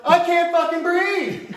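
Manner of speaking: mock panic. Kid stuck in sofa bed.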